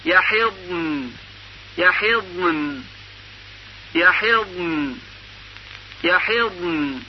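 یقبضن drawn out slowly, ending on a soft ن; slow careful recitation. Shown as a demonstration. Teacher models the ض before sakin nun. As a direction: say یقبضن slowly with great care. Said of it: "با دقت خیلی زیاد".

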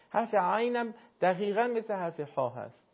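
usual speaking voice

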